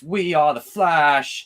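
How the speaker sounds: mock heroic proclamation; quoted line. Quoting Jacob's favorite Flash line, mock heroic and slow. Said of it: "Jacob's favorite Flash line is".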